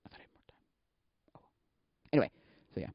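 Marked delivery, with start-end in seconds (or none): 0.1-1.5 very faint, voiceless whisper; whispered to self. Real, not acted. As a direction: whisper it privately, off to yourself. Muttering to self off track.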